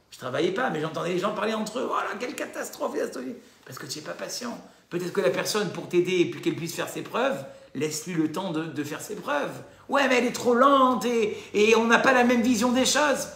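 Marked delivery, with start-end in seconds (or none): 1.9-3.4 high, mock-dismayed gossip; higher mock-lament about partners; gossiping complainers. Overheard people complaining about partners. 9.9-13.3 louder, higher whiny objection; exasperated grumbling; impatient complaint. Retold or imitated.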